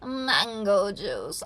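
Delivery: extremely drawn-out weird voice; very slow, oddball impression; crazy lady. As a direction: stretch each word out like an eccentric woman. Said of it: "how did she speak? It was like".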